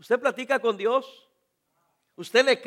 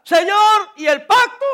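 high, loud plea to God; David's reclaiming cry; 9 semitones higher, 9 dB louder than usual. Retold or imitated. David in distress crying out to the Lord, pleading loudly. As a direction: demand it like a wronged friend. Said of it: "David exclama y reclama".

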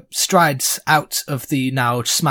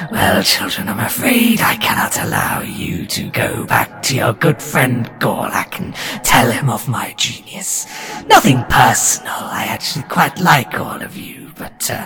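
ooze monster voice; 2 semitones lower than usual. Acted. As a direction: speak like an ancient, scheming monster. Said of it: "grins and laughs and says".

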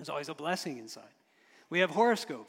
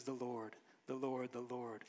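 "the Lord, the Lord, the Lord" said softer and lower, reverently; hushed repetition; 5 semitones lower, 11 dB quieter than usual. Shown as a demonstration. Preacher repeats the threefold name again, hushed and reverent. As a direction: drop the voice on each "the Lord". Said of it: "But three times it says".

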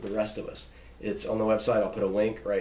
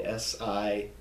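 ordinary talk

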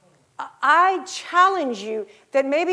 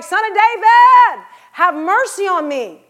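a much higher, louder, slow plea; loud desperate cry; 7 semitones higher, 10 dB louder, slower than usual. Retold or imitated. Blind beggar crying out to Jesus, loud and slow. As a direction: call it out louder, stretching each word. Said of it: "You get louder".

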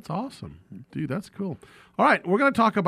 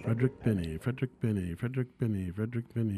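dreamy distant voice; 5 semitones lower, 9 dB quieter, faster than usual. Acted. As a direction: breathe the name like a faraway echo. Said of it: "That sounds, that sounds like a dreamy voice there".

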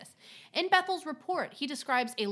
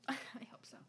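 whisper; 16 dB quieter than usual. Real, not acted. Catching the soda fizz audio.

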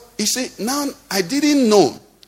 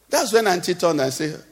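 ordinary delivery

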